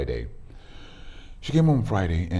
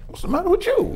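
much higher and louder, firing the question back; mock angry retort; 6 dB louder than usual. Husband snapping back at his wife in a retold fight, mock angry. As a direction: throw her words back at her, hammed-up.

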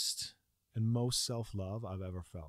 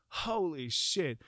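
ordinary talk, nothing marked

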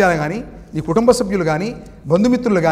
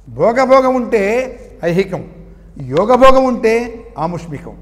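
slow emphatic; 4 semitones higher, 6 dB louder, much slower than usual. Acted. Sermon point, slow emphatic.

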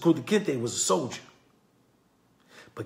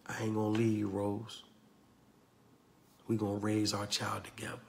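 much softer and lower, a quiet vow; tender promise; 10 semitones lower, 15 dB quieter than usual. Retold or imitated. Kunta quietly promising Rose he will stay, soft and low. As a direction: promise her softly.

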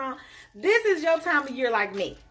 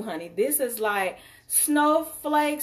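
usual speaking voice

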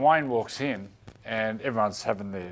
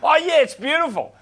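much higher, louder squeaky player voice; mock nervous player; 9 dB louder than usual. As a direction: fake it sheepishly to the coach. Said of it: "Bedsy goes".

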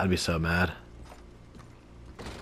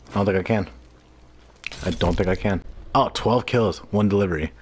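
ordinary talk

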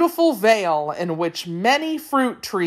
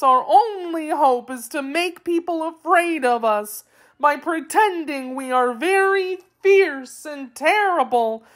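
sobbing; 9 semitones higher than usual. A narrator's reading. Wheeler confessing his secret to his captors, theatrical sobbing. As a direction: wail it like a helpless storybook creature. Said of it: "he sobbed".

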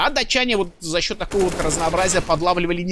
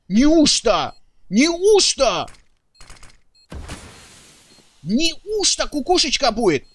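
excited; 6 semitones higher, 3 dB louder, slower than usual. Real, not acted. Caster anticipates clutch.

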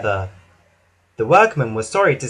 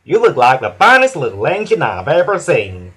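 loud, bright compliment from a big engine; Hank's voice; 5 dB louder than usual. A narrator's reading. Hank praising Thomas, loud and friendly. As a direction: flatter the little engine warmly. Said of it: "whistled Hank".

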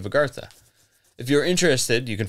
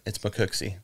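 American accent; 6 dB quieter than usual. Shown as a demonstration. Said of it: "I'm gonna say it with my American accent".

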